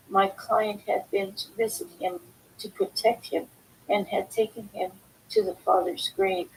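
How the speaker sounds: normal talking voice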